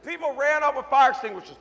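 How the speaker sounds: normal talking voice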